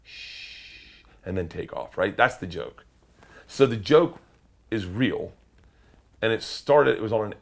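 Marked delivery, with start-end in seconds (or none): 0.0-1.0 soft, drawn-out voiceless "shh"; calm shush. Shown as a demonstration. Driver silencing cop with finger on lips, calm shush. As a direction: hush someone gently and slowly. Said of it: "very calmly take your finger".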